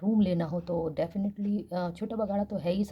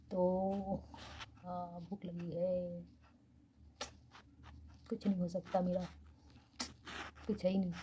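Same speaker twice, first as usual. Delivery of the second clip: speaking softly; 9 dB quieter than usual. Real, not acted. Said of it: "धीरे-धीरे बोल रही हूँ भाई".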